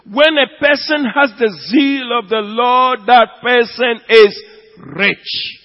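loud, high preaching build; full-force preaching. Acted. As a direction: call the church to zeal.

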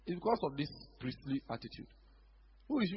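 ordinary delivery

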